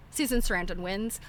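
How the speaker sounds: ordinary delivery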